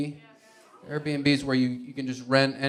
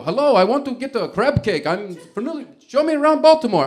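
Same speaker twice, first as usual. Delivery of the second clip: higher, hammed-up Italian tourist; badly faked Italian accent, eager to go; 8 semitones higher, 6 dB louder than usual. Italian visitor asking to see Baltimore, hammed-up Italian accent. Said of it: "I can't do an Italian accent".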